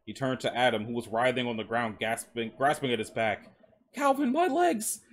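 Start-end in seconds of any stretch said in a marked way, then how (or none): 3.9-5.0 high, strained cry of agony; agonized high-pitched plea; pained, clenched teeth. A narrator's reading. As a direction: force it out slowly through clenched teeth. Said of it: "he mumbled through clenched teeth".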